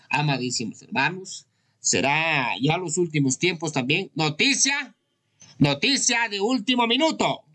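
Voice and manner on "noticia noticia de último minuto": high-pitched breaking-news announcement; urgent newscaster's call, high and ringing; news flash. Pretend. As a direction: announce a bulletin, pitched well up.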